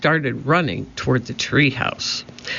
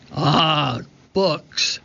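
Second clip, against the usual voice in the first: disgusted pirate captain. A narrator's reading. Captain disgusted by books again.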